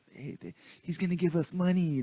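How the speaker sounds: put-on high, eager boy's voice; imitating excited kid. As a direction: lift the pitch like a kid spotting cash. Retold boys seeing cash held up.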